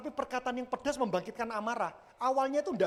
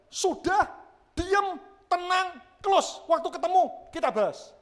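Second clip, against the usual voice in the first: high, loud word-by-word order; insistent call to calm down; emphatic admonition; 9 semitones higher, 6 dB louder, slower than usual. Retold or imitated. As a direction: punch out each instruction, high and loud. Quotes own advice to quarrelling church group.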